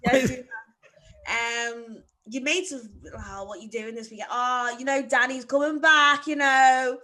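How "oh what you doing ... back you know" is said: loud, cheery Australian lads voice, a bit hammed; mock Aussie accent. Friend mocks Australian mates at home race, loud hammed Aussie accent. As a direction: imitate Aussie mates hyping Danny up, loud.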